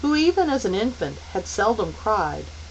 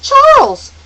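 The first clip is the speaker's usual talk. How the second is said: very high, loud scream; screamed name; 15 dB louder than usual. A narrator's reading. Meg screaming at her little brother. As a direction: scream his name in alarm. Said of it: "Meg screamed".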